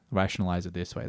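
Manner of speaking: usual speaking voice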